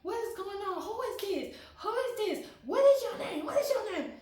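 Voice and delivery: high mock yell of a startled mother; retold high-pitched demand from a startled mom; mom shouting in shock. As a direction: play the shocked mom at a stranger. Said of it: "she was like".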